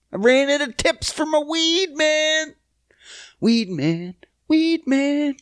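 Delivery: high hammed-up whine; mock crying. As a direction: wail it like a pretend tragedy. Mock crying over first world problems.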